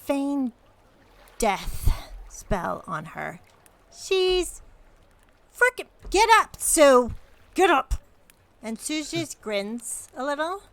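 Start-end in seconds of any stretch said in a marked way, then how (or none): 0.0-3.4 louder, slow and deliberate; deliberately explaining the spell to Emma. Acted. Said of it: "she goes". 3.9-7.9 much louder and higher, urgent; shouting at Sue to wake. Acted. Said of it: "she goes".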